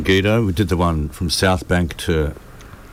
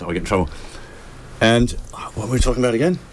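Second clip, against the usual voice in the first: ordinary delivery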